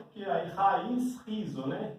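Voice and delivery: ordinary talk, nothing marked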